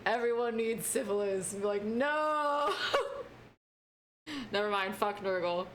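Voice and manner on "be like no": shrill pretend wail; mock cry. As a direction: cry out no in protest.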